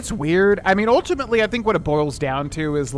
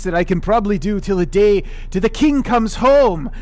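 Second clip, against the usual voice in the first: Scottish accent; 6 semitones higher, 6 dB louder than usual. Shown as a demonstration. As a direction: slip briefly into a playful Scottish accent. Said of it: "like Scottish accents".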